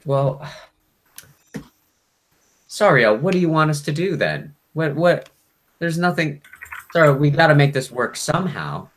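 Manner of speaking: a demon begging its host to cooperate; pleading demon. Acted. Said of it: "And then".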